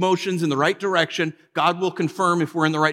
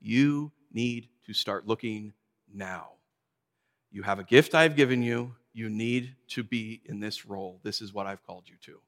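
hushed low voice of God; still small voice; 7 semitones lower, 6 dB quieter than usual. Retold or imitated. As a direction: speak it gently and deep like a quiet inner voice. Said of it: "God the Holy Spirit say".

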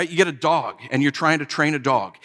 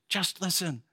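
soft repeat; 3 semitones higher, 10 dB quieter than usual. Shown as a demonstration.

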